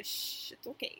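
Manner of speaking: whispered hush; very soft mock comforting whisper; soothing whisper. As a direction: shush someone gently, all breath. Imitating someone soothing a crier.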